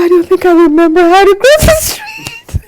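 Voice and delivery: high, giggly admission; laughing confession. Retold or imitated. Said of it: "I go".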